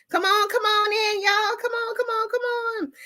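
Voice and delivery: high singing call to the viewers; sing-song welcome. Acted. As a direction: sing them into the room. Calling viewers into stream.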